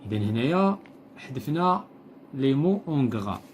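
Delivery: usual speaking voice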